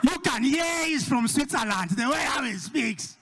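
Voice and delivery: high, slow, admiring voice with a cry of delight; swooning Nigerian girl. Retold or imitated. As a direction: gush over a foreign-sounding man. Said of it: "the Nigerian girl is like".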